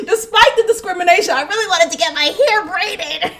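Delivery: high, quick words through laughter; amused, giggly admission; laughing. Real, not acted. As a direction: confess it while cracking up. Still cracking up at her admission.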